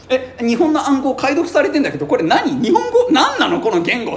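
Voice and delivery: loud, high, flustered confusion at an unreadable code; "what language is this?!" bewildered and loud; baffled panic. Retold or imitated. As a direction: panic like a stumped codebreaker. US codebreakers baffled by dialect.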